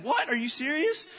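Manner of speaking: high-pitched incredulous question; disbelieving. Retold or imitated. Child hearing older siblings' trash can story, disbelieving. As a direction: ask it in high mock disbelief. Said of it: "I didn't believe them".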